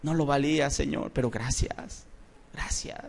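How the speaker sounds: fading to a hushed, near-silent "gracias"; fading thankful prayer. Retold or imitated. Believer thanking the Lord, fading to a hush. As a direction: let the prayer die away into soft thanks. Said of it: "se pone a llorar porque dice".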